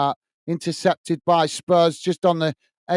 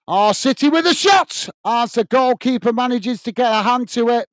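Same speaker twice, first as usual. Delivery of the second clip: higher, excited live shot call; raised-pitch match excitement; excited commentary; 6 semitones higher, 3 dB louder than usual. Real, not acted.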